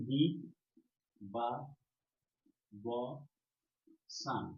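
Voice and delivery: দি বা ব সান with long pauses between syllables. Shown as a demonstration. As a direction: isolate each syllable of দি বা ব সান. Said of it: "প্রথমে উচ্চারণ করছি".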